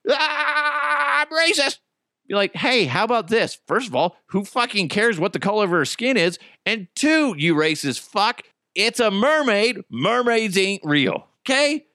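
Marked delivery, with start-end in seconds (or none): 0.1-1.8 high, whiny mock outrage; hammed-up hysterics, pitched way up; mock freak-out. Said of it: "All the racist people are freaking out". 2.5-11.9 higher-pitched, emphatic scolding; exasperated telling-off. Acted. Acted telling-off of the racists.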